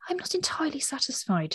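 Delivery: musing. A narrator's reading. Old gentleman considering his accuser, musing aloud. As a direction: ponder it as if searching your memory. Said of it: "he mused".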